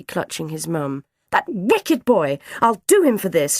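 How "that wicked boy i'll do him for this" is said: outraged mother's loud threat; loud, vengeful outburst; angry shout. A narrator's reading. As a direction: shout in indignation, pitched high. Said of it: "shouted Ma Haines".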